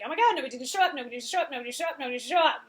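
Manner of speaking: lightly voiced anxious poodle, speeding up as it repeats; a hint of a yappy, fretting little dog gabbling on; frantic yapping poodle. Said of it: "the poodle is like".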